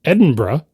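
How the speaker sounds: Edinburgh squeezed to "Edin-bruh"; hurried pronunciation. Shown as a demonstration. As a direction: swallow the vowel so Edinburgh ends in "bruh". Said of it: "in more of a hurry".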